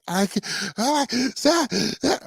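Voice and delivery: high, strained mock cries of a child; mock screaming child. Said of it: "blood-curdling screams".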